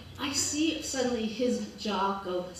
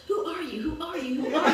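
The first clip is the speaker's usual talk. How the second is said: echoing like looming cartoon words in her head; scary cartoon echo; 4 semitones higher than usual. Storyteller replaying the stunned echo in her mind, cartoonish. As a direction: let the words ring back at her like a cartoon. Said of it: "these big, scary cartoon words".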